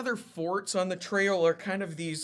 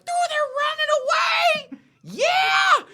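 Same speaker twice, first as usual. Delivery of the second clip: much higher mock cheering shout, louder; hammed-up gleeful British soldiers; 8 dB louder, slower than usual. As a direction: yell it like a triumphant redcoat who thinks the enemy is fleeing. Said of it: "So the British are like".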